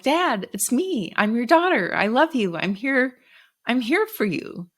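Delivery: retold plea to a frightened father, pitched high; pleading. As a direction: plead with Dad to trust you, voice lifted. Daughter pleading with delirious father.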